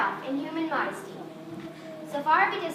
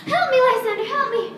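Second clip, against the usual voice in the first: much higher and louder cry for help; terrified cry; 7 semitones higher, 8 dB louder than usual. Acted. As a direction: scream for help out of a nightmare. Hermia wakes from a nightmare.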